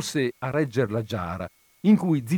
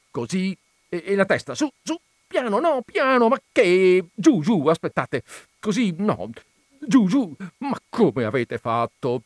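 high, urgent instructions in bursts; coax, then object in mounting alarm; agitated, louder as it goes wrong; flustered directing; 7 semitones higher, 5 dB louder than usual. A narrator's reading. Flustered owner directing a rescue attempt.

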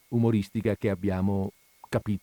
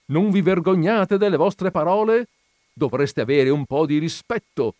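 high, loud scolding; outraged shout; 8 semitones higher, 6 dB louder than usual. A narrator's reading. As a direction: shame him indignantly. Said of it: "gridò la vedova". (rimprovero gridato, indignato)

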